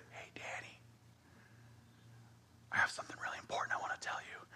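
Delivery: barely audible, voiceless whisper; dad's whisper. Acted. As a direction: whisper it like a dad with important news. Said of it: "The whisper actually makes us quiet".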